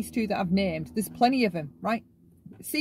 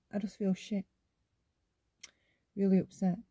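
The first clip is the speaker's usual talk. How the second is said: understated sadness; 2 semitones lower, 6 dB quieter than usual. Shown as a demonstration. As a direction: say it quietly, without any show. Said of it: "That would be healthy".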